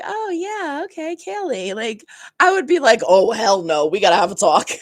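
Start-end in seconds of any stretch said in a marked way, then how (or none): 0.0-1.7 high-pitched, airy imitation of easy compliance; mock unbothered agreement. Imitating Belly going along with Kayleigh, mock-casual. 3.1-4.7 loud, emphatic mock objection; indignant refusal. Imagined objection to the wedding planner, indignant.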